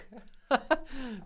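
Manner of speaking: high giggling laugh; real laugh. Real, not acted. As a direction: laugh it off after a loss. Laughs after failing.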